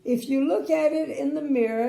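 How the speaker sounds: announcing proudly. A narrator's reading. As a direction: present the clock triumphantly. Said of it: "he announced".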